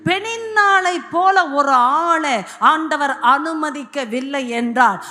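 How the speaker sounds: sermon climax calling for praise; forceful preaching. Real, not acted. Preaches, building to hallelujah.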